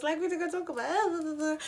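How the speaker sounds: high, loud, rushed begging; frantic bargaining with boyfriend, loud and high; desperate pleading. Retold or imitated. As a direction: bargain frantically to keep him. Begging boyfriend in retold breakup.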